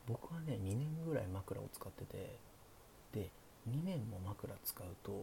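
hushed late-night chat, often whispered; sleepy. Pretend. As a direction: talk softly like the listener is drifting off. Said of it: "寝落ち配信なんでね". (小声でささやく寝落ち配信トーク)

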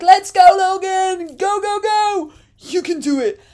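high, loud cheer from the couch; cheering shout. Retold or imitated. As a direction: shout for him, jumping up. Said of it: "shouting like".

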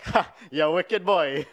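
high, delighted Trinidadian grandma impression; Trini accent. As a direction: imitate a Trini grandma's exclamation. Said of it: "In a Trini accent".

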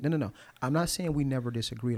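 ordinary delivery

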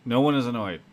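ordinary talk, nothing marked

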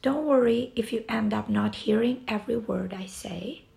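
normal talking voice

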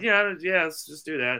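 high-pitched retold assent; quick agreement. Agreeing to smoke with the stranger, high-pitched. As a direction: agree readily, voice jumping up.

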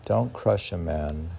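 slow, hushed poem recital sinking low; poem reading. Acted. Reads translated poem aloud.

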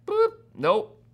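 high, loud flippant brush-off; mock-breezy rejection; dismissive. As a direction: swat the idea away, punchy and high. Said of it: "You're like".

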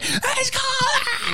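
very high mock wail; hammed-up squealing cry; imitated crying child. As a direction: wail it like a small kid in tears. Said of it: "immediately start crying".

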